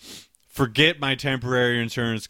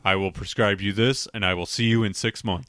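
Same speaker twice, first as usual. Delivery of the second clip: lower, deadpan physician voice; monotone doctor; 4 semitones lower than usual. Acted. Co-host playing a doctor giving a patient instructions, monotone. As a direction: recite it like a sterile, straight-laced doctor.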